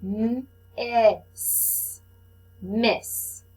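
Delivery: "M-I-S" letter by letter, then "miss" blended; teacher sounding out the first syllable. Shown as a demonstration. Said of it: "our first syllable is right here".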